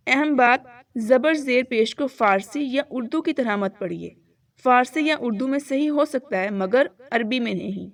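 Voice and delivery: normal talking voice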